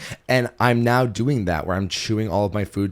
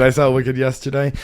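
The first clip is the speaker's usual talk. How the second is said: normal talking voice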